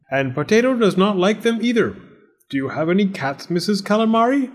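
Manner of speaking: high, theatrical storybook landlord; fussy cat-hating landlord. Acted. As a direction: voice a landlord who can't abide cats.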